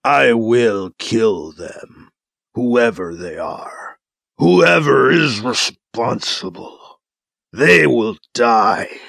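low, slow menacing growl; violent growl. A narrator's reading. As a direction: growl the threat slowly. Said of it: "his voice a violent growl".

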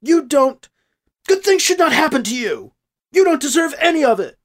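a mother confronting the man she blames, raising her voice in anger. A narrator's reading. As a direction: let your voice rise at him in anger. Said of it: "as she raises her voice".

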